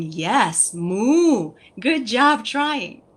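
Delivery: put-on slow, drawn-out praise to a toddler; encouraging praise. Shown as a demonstration. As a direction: stretch it out gently for the child. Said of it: "you're going".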